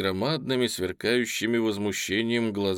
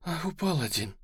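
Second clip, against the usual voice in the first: higher, softer exclamation; pained cry, understated; 7 semitones higher, 4 dB quieter than usual. A narrator's reading. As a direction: let out a small pained cry. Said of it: "вскрикивает Вера Митрофановна".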